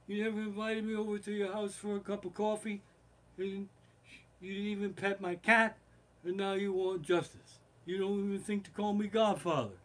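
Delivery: imitated crime boss, high and held-back; Godfather.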